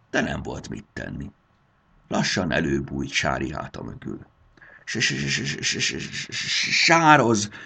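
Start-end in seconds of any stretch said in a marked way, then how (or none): 4.8-7.5 higher, stuttering terrified greeting; a scared girl barely getting the word out; frightened stammer. Acted. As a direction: stammer out the name in fear. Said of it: "nyökögte".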